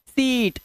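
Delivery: सीट with a long drawn-out ee; long vowel. Shown as a demonstration. Teacher showing the long vowel, सीट drawn out. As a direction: stretch the ee in सीट. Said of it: "ये बड़ी की मात्रा होती है".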